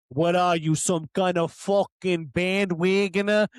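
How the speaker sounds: exaggerated East Coast accent impression; imitated East Coast accent. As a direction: mimic an East Coast guy's accent, challenging. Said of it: "literally says it like that".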